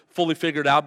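ordinary delivery

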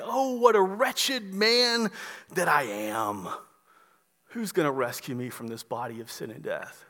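despairing lament that fades; wretched lament. Acted. Paul's lament acted out.

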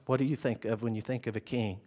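low, hushed and measured; quiet, searching undertone; soft probing question. Acted. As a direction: ask it very softly, down low. Question repeated softly.

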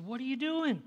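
retold steward's startled, high-pitched question. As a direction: catch someone in the act, voice jumping up. Steward catches a man rummaging.

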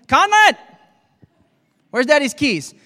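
loud, high-pitched parental shout; calling out to child. Acted. Dad calls his child to find lost keys, calling out loudly. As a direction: holler for the kid's help.

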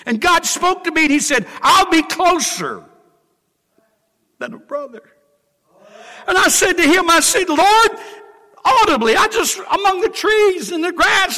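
high, loud preaching climax, every line ringing out; full-force preaching. Acted. Preacher to congregation, recalling God's answer: soaring full-voiced preaching. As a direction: lift the story up and proclaim it to the church.

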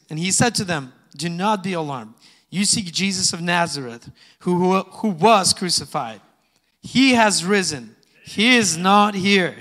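loud, slowing proclamation of the angel's words; preaching scripture. Acted. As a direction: proclaim it to the whole church. Reading scripture at full force.

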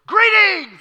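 jump-scare greeting, yelled; surprise shout. Shown as a demonstration. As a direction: blast "Greetings" out to startle her. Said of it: "like he's gonna surprise her".

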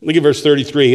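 normal talking voice

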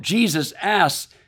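normal talking voice

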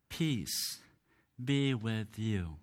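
slow, soft and low, with a pause after "Peace"; solemn blessing. Retold or imitated. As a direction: give it gently, as a heartfelt blessing. Jesus's blessing, quoted with weight.

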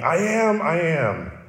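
proclaiming "I am, I am"; emphatic I am. Shown as a demonstration. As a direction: ring out the first "I am, I am". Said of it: "Ego eimi".

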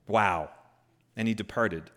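normal talking voice

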